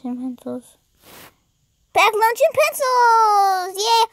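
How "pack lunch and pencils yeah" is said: higher, drawn-out excited call; excited cheer. Real, not acted. Player picking what baby takes to school, excited cheer. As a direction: sing out the choice slowly with excitement. Cheers the option just read out.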